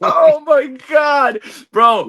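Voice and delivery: losing it over a funny memory; delighted outburst. Real, not acted. Reacting to his cohost's Pete Rose story, delighted.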